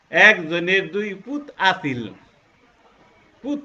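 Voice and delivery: slow Mymensingh dialect, stressing পুত. Shown as a demonstration. Teacher demonstrating Mymensingh speech slowly. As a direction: lean into পুত like a Mymensingh local. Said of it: "আমি যখন ময়মনসিংহে যাব".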